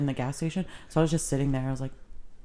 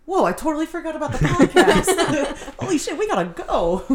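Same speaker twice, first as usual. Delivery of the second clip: high, louder laughing panic; laughing pretend alarm; mock panic; 11 semitones higher, 5 dB louder than usual. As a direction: blurt it as if just remembering. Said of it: "And you were like".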